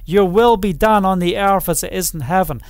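ordinary talk

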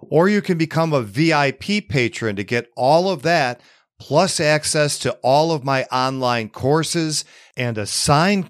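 upbeat promo read over music. Acted. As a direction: sell it like a podcast sponsor spot. Host reads sponsor message.

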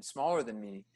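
normal talking voice

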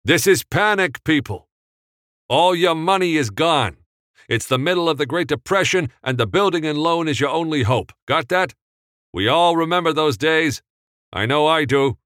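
loud, high, pushy pep talk; rousing the extras. Acted. Director firing up the extras before the take, loud and high. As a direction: fire up the crowd like a director. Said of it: "held up one hand dramatically".

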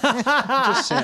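high giggling just saying; laughing tease. Real, not acted. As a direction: needle them while laughing. Teasing laugh.

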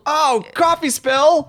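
high, slow amazed ad customer; infomercial. Pretend. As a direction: marvel at the product, drawn out.

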